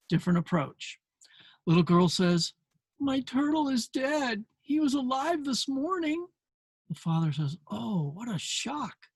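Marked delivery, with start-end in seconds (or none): 3.0-6.3 lightly voiced sad little girl; upset little girl. Same girl line, gentle retelling. 7.7-9.1 soft, slow, sympathetic father; understated tender father; gentle father. Retold or imitated. Father naming feeling gently.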